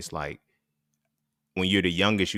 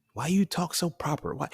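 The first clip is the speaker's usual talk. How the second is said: higher-pitched teasing imitation; mocking question; 10 semitones higher than usual. Siblings teasing the kid who talks proper, mocking. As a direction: mimic a sibling needling him.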